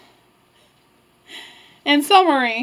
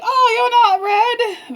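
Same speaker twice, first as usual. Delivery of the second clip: high, drawn-out grandma voice; startled grandma; 11 semitones higher, much slower than usual. Acted. Grandma seeing the wolf instead of Red, startled. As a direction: exclaim it high and slow, as Grandma.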